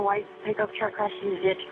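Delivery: ordinary talk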